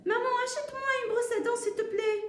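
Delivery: put-on high, sustained pleading child voice; child asking mum. Jade asks mum for a toothbrush, high child voice. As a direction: play a little kid begging at high pitch.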